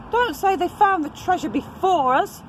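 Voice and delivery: high squeaky pirate voice; pirate Percy Patch. Acted. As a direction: pitch it way up like a fussy little pirate.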